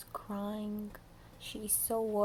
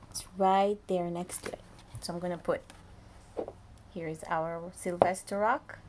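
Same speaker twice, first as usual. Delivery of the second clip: ordinary delivery, nothing marked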